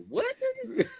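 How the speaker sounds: mock Siri voice. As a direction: play Siri as fed up, squeaking through a laugh. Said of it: "No, Siri be like".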